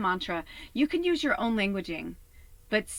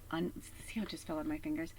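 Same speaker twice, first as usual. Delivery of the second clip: quick hushed whispered aside; 7 dB quieter, much faster than usual. Real, not acted. Remarking to viewers that the card slipped from her fingers, in a fast whisper. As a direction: mutter it fast under your breath.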